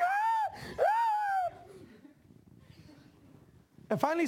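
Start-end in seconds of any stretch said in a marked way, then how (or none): 0.0-1.5 very high imitated scream of a panicking kid; mock child's cry. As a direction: squeal like a child struggling in water. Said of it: "he's like".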